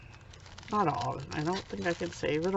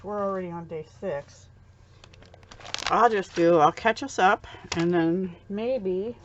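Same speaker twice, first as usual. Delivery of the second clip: usual speaking voice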